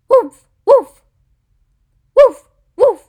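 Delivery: high, very loud short barks; dog barking. Shown as a demonstration. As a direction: bark like an excited little dog. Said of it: "It's a dog".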